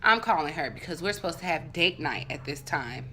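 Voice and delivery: ordinary delivery, nothing marked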